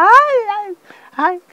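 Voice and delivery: high, loud mock wail; imitated screaming. Girl inside the chapel, imitated loud screaming. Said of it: "La pelada gritaba".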